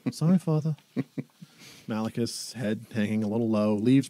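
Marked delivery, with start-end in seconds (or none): 0.1-0.8 put-on sheepish boy voice. Scolded boy apologizes.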